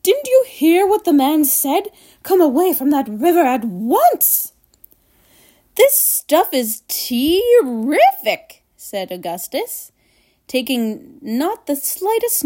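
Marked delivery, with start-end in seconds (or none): 0.0-4.5 scolding mother, raised and higher; high-pitched motherly scolding; calling out. A narrator's reading. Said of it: "called out Missus Gloop". 5.8-8.6 slow, higher, relishing every word; thrilled boy, stretched-out words; greedy delight. A narrator's reading.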